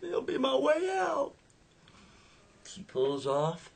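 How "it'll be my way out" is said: put-on high, despairing young woman's voice; Becky's voice. Woman in car, talking herself into risk, high despairing. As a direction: pitch it way up, reckless and hopeless. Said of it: "She's like".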